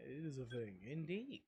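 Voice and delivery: usual speaking voice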